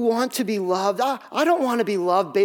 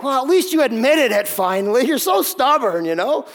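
imitating his wife, higher and louder, scolding; mock scolding wife; 4 semitones higher, 5 dB louder than usual. Husband imitating the scolding he expected from his wife. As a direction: play the wife nagging him, a bit hammed-up.

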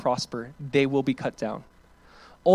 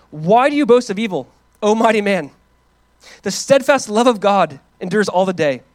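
impassioned reading; 5 semitones higher, 8 dB louder than usual. Acted. Reading David's angry psalm.

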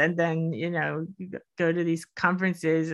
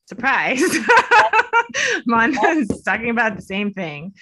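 laughing; 5 semitones higher, 7 dB louder than usual. Real, not acted. Sarcastic surprise, laughing.

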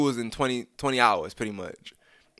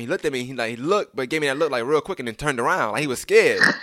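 ordinary talk

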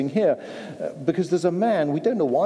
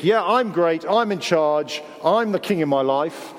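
mock self-important; 3 semitones higher than usual. Preacher voicing a proud person's thoughts, mock boastful. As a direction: puff yourself up as if you rule. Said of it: "if you think".

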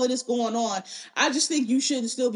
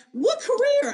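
high incredulous scoff, a bit louder; mock disbelief; 12 semitones higher, 3 dB louder, slower than usual. Talking about Jada, a high incredulous scoff. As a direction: scoff at the very idea of her career. Scoffing at Jada's career claim.

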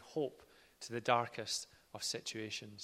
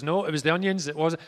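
a louder, higher mock protest; denying dad; 5 semitones higher, 10 dB louder than usual. Jay denying his tears to Phil, mock indignant. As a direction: huff that it was only the onions. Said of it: "He goes".

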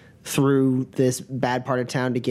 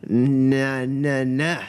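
put-on drunk; much slower than usual. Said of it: "kinda wobbling as he's standing there".

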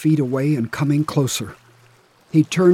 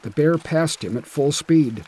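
plain reading voice

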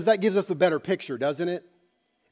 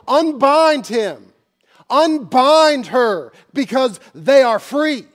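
slow, loud, much higher decree; booming unbind him; commanding shout; 7 semitones higher, 10 dB louder, much slower than usual. Acted. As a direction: order the devil to let go. Preacher voicing Jesus to devil.

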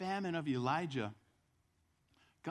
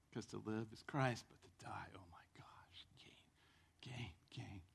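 awed whisper; 6 semitones lower, 11 dB quieter than usual. Real, not acted. Preacher marveling that to die is gain, whispering. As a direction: whisper it in awe. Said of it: "whispered awe".

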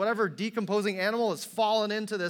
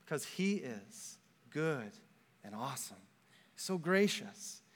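hushed reverence; 6 semitones lower, 9 dB quieter than usual. Real, not acted. Reverent contrast, voice drops.

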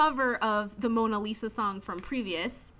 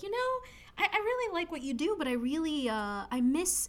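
higher, softer, hesitant talk-show host; Jimmy Fallon; 4 semitones higher, 4 dB quieter than usual. Retold or imitated. Host confessing to guest he misses the band, soft and hesitant. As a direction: stammer it softly and coaxingly. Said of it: "Jimmy Fallon is like".

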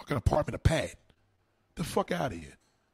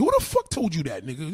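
usual speaking voice

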